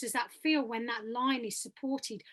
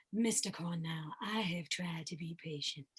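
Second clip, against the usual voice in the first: low character voice; 8 semitones lower, 9 dB quieter than usual. Shown as a demonstration. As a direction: drop right down low and keep it quiet. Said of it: "a character voice down here".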